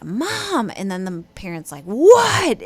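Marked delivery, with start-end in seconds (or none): 0.0-0.7 put-on exasperated child's loud high call; frustrated child calling out. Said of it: "finally the kid breaks and is like". 1.8-2.6 much higher, much louder, annoyed What?; startled, annoyed parent snapping back; irritated snap. Retold or imitated. Parent distracted, snapping at child.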